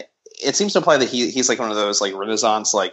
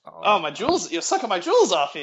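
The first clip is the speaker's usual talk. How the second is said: pitched up, fussy complaint; high flustered; 8 semitones higher than usual. Pretend. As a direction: fuss high like a flustered pope. Pope flustered.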